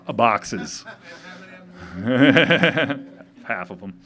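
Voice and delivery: words squeezed out through laughter; real giggling reply to the crowd; laughing. Real, not acted. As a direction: laugh while you answer, slowing right down. Laughs answering a joke about shirts.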